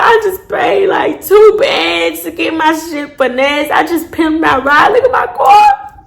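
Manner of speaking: high, whiny retold lament; mock hurt. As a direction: wail over the money wasted on the car. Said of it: "I was so hurt".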